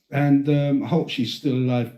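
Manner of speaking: ordinary talk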